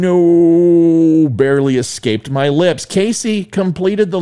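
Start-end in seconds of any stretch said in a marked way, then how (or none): 0.0-1.3 a louder, higher, drawn-out no; hammed-up forlorn no; resounding no. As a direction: belt out the no, mock dramatic. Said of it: "a resounding".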